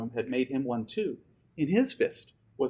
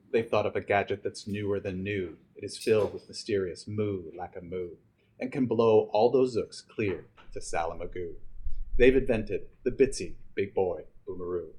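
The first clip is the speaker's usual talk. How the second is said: a hint of a low, soothing leader; calm the boy with grand plans; lightly voiced chief; 6 semitones lower than usual. Chief reassures the boy about the new bomb, slightly lower calm voice. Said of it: "said the chief".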